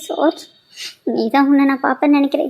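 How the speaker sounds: usual speaking voice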